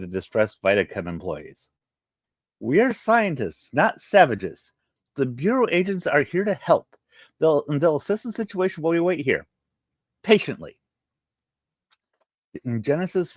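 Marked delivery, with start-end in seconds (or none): 2.6-10.8 stern scolding. Acted. Bakarian woman scolding the distressed employees sternly. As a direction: lecture them like children.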